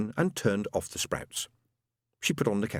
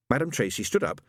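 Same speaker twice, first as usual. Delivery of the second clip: ordinary delivery, nothing marked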